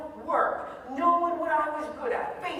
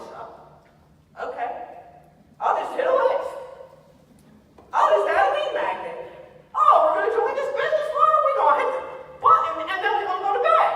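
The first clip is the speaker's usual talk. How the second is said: mock carefree; 11 semitones higher, 10 dB louder than usual. Mocking carefree marketers' reply.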